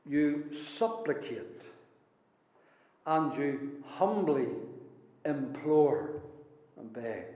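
soft, low, with reverent pauses; humble supplication. Acted. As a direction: drop low and quiet, as if humbly petitioning. Preacher models humble pleading in prayer.

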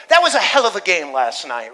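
loud, offhand sports-fan remark; loud, easygoing quote of a fan; casual exclamation. Retold or imitated. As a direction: toss it out like a fan after the game. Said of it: "we say to people".

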